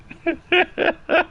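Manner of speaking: high squeaky nervous laugh. Real, not acted. Shaken after a ghost scare, high nervous laugh. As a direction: laugh off the fright.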